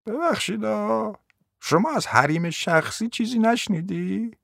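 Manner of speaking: high, soft, drawn-out reproach; affronted grandmother, slow and high; mock-polite indignation. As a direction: stretch the words in quiet affront. Grandmother protests intrusion on toilet.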